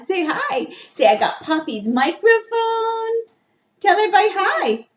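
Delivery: high coaxing singsong. Real, not acted. Coaxing toddler at microphone.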